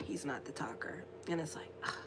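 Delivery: hushed confiding aside; whisper. Real, not acted. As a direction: whisper it like a secret. Confiding aside about quiet boyfriend.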